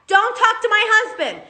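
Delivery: high, heated warning; retold angry yelling. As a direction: snap at him to leave her husband alone. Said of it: "And I go".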